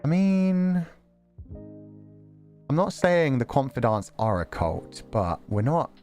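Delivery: ordinary talk, nothing marked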